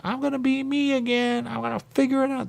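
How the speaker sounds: mock self voice. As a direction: squeak it with naive confidence. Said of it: "'cause I'm like".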